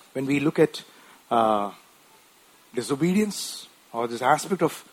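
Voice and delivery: ordinary delivery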